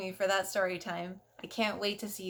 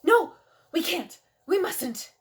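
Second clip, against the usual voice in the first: mock alarm; 6 semitones higher, 5 dB louder than usual. Scolding herself about the button.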